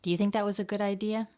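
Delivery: ordinary delivery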